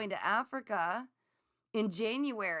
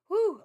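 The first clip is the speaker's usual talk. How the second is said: whew; 11 semitones higher, 11 dB louder than usual. Real, not acted. Overwhelmed by sudden heat.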